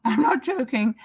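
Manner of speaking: ordinary talk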